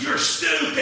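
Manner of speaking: loud, high-pitched mock taunt; jeering kids. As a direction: holler it like children mocking another child. Kids jeer at the girl.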